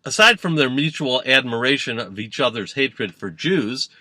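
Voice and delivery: usual speaking voice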